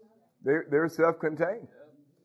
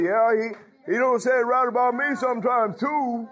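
high, pushed-up complaining churchgoer voice; mock grumbling member; 9 semitones higher, 4 dB louder than usual. Pastor mocking a congregant who grumbles about his preaching, high whiny voice. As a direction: whine it like a sulky parishioner. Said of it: "Don't, don't say".

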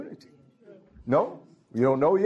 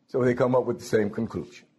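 ordinary talk, nothing marked

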